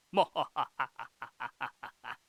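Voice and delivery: loud, low mwa-ha-ha; hammed-up mastermind cackle; long staccato gloating laugh; evil villain laugh. Mister Mind's gloating villain laugh.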